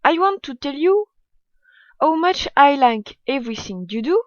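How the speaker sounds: English read aloud with a French accent; non-native English, distinctly French accent. Real, not acted. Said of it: "Désolée pour mon accent, je sais qu'il est très mauvais".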